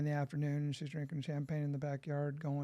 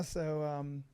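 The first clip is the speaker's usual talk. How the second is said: ordinary talk, nothing marked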